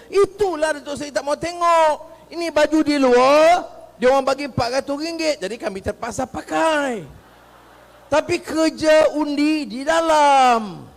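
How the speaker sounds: high, loud, emphatic explanation; imitated youths calling. Youths in BN shirts explain themselves to Datuk Seri, calling out. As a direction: call it out, building to the punchline.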